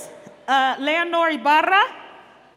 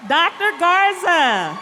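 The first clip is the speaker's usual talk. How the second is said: game show call; 4 dB louder than usual. Acted. Presenter calling winners up on stage, loud game-show announcer. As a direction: call them up like a TV host.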